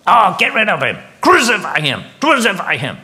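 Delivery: high, loud furious mob cry; angry crowd shout. Retold or imitated. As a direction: bellow it like a crowd turned on him. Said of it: "they express it by what?".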